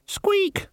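tiny high-pitched squeak; high rat squeak. A narrator's reading.

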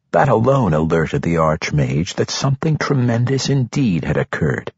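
usual speaking voice

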